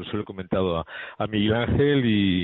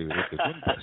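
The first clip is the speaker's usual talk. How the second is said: ordinary talk, nothing marked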